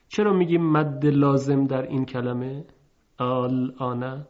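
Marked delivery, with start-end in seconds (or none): none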